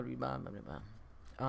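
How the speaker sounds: usual speaking voice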